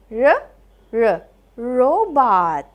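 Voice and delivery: r, r, then robot; phonics sounding out. Shown as a demonstration. Teacher sounds out robot for kids, phonics style. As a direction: say r, r before robot. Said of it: "let's sound out their sounds".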